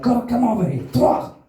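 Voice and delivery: high, loud game-fighter shout; hammed-up Mortal Kombat battle cry; mock fighter yell. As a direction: bark it like a video game fighter. Attacker shouting, Mortal Kombat style.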